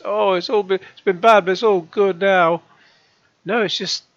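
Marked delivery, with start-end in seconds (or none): 0.0-2.6 mocking. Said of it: "sounds like he's just come out of a terminal cancer diagnosis".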